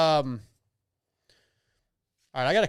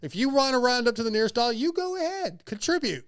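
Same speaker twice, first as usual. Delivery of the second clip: sarcastic, much higher, mocking the cashier prompt; sarcastic mockery; 9 semitones higher than usual. Real, not acted. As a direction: scoff at the round-up request, pitched way up. Mocking stores asking to round up.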